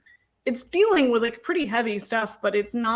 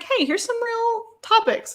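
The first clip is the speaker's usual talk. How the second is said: a touch higher, chirpy aside; light mock announcement; 12 semitones higher than usual. Anime chirpily introducing serious topics, lightly mocking. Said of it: "still is like".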